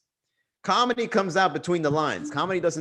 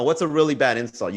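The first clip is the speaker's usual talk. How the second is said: ordinary talk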